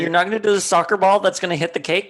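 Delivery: high, fast, fed-up rant; exasperated. Retold or imitated. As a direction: rant at her in disbelief. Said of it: "I was like".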